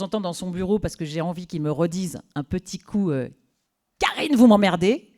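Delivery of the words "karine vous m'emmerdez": loud replay of the boss's outburst; mock yell. Temp relishing the boss's outburst, loud mock yell. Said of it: "que je kiffe".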